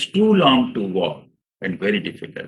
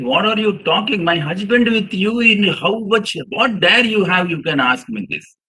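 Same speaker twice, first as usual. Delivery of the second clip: higher-pitched indignant protest; agitated lady; 7 semitones higher than usual. Retold or imitated. Lady protesting Bernard Shaw's offer to her, indignant. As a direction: hurl the lady's offended questions back. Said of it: "this lady got agitated".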